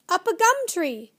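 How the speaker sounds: high, loud shrieking bird call; bird screaming. A narrator's reading. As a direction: screech it like a frantic bird. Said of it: "shrieked the wiffle bird again".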